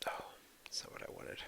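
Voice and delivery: under-the-breath mutter to self; real aside caught off-mic; whispered aside. Real, not acted. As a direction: whisper it to yourself, annoyed at the slide. Muttered aside while fumbling slides.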